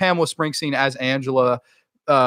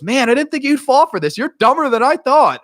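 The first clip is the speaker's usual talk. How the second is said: high, loud gloating jeer; mock taunting; 11 semitones higher, 5 dB louder than usual. Killer to the girl who fell for her trap, high mocking taunt. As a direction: taunt the victim in a raised voice.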